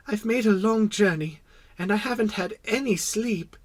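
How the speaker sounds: put-on high-pitched small boy voice; apologetic little prince. Little prince explaining his yawn to the king, apologetic. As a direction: plead your excuse in a high voice.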